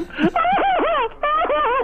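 high, mock crying wail; mock wailing sob. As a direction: imitate a wife bursting into tears. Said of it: "this woman goes".

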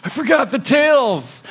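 high-pitched retold cry of dismay; mock panicked exclamation; sudden realization. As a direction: blurt it like a forgetful gasp. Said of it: "I'll be like".

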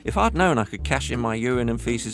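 high, gushing puppy voice; excited puppy voice. Retold or imitated. Puppy telling the Akita about the liver treats, delighted. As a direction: rave like a delighted little puppy. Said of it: "go down the garden to Akita and say".

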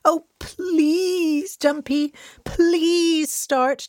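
high, drawn-out begging; pleading. A narrator's reading. Charlie begs the truck to start, pleading high. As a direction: plead as if close to tears. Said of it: "Charlie pleaded".

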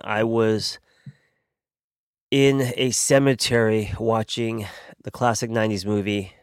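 usual speaking voice

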